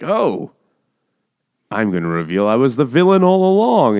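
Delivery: high, loud, hammed-up villain; mock villain reveal. Duke revealing he was the villain, hammed-up gloating.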